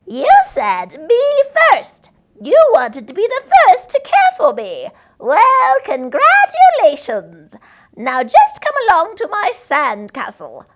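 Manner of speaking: cackling creature voice. A narrator's reading. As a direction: gloat like a little witch. Sandwich creature speaks.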